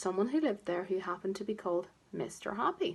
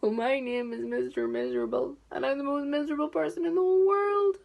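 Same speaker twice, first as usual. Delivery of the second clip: higher, louder wailing misery, rising to a cry; miserable voice; 6 semitones higher, 5 dB louder than usual. A narrator's reading. Mister Miserable tells Mister Happy his name, wailing miserably. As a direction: moan it out as the saddest man alive.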